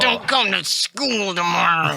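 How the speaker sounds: slow, put-on whiny warning; hammed-up slow character voice; mock Metz voice. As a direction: drag it out in a goofy put-on voice. Impersonating Metz warning a classmate.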